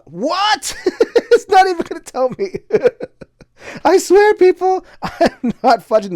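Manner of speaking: high, loud laughing surprise; real astonishment at the roll; laughing disbelief. Real, not acted. Player reacts to dice, high laughing disbelief.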